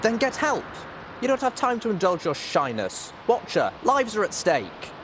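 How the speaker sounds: high, forceful demand; urgent cross shouting. A narrator's reading. Little Pip demanding Watcher get help, urgent cross shouting.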